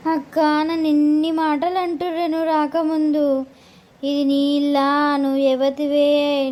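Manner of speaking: aggrieved complaining sister. Acted.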